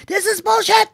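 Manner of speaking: high mock yell at the play; a professor yelling in protest; an audience member shouting in outrage; retold yell. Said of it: "he's yelling".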